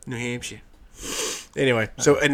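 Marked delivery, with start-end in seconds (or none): 0.1-0.6 soft, falling Boston-style Hampshire; fake Bostonian accent. Co-host confirms the place to the host, fake Bostonian accent. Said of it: "With our fake Bostonian accents".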